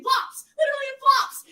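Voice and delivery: very high, loud disbelief; incredulous. Real, not acted. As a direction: shriek it in disbelief. Exclaiming about flip-flops.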